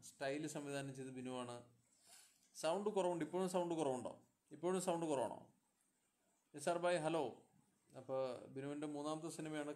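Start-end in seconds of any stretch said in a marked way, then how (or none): 2.5-7.2 loud, raised checking voice; talking loudly. Real, not acted. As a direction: call out to be heard over weak audio. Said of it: "ഇത്രയും ഉച്ചത്തിൽ സംസാരിച്ചിട്ട്".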